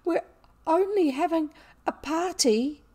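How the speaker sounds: high-pitched, upset protest; tearful plea. A narrator's reading. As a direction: explain desperately, close to tears. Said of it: "Ermengarde burst into tears".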